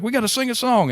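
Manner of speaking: high, thrilled cry; eager old lady. Retold or imitated. Lady at the bar urging a sing-along, high eager voice. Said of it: "she was like".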